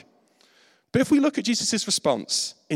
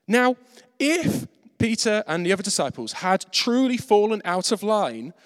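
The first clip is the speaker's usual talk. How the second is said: usual speaking voice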